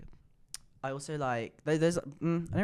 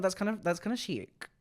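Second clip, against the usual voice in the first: higher, put-on camp voice on kinda; camp approval; 7 semitones higher, 4 dB quieter than usual. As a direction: purr it like a fashion critic. Camp reaction to viewer.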